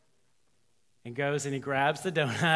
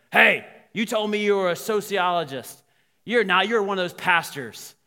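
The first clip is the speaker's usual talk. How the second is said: loud, raised-pitch confrontation; mock-indignant outburst; acted accusation; 5 semitones higher, 9 dB louder than usual. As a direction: call him out on a deception. Frank confronts Tony in story.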